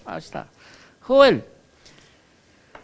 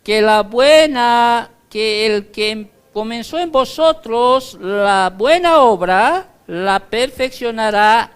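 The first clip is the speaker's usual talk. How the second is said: raised, emphatic Bible reading; scripture reading; 3 semitones higher, 6 dB louder than usual. Acted. Preacher reading the verse to his congregation, raised and emphatic. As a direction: proclaim the verse slowly and loudly.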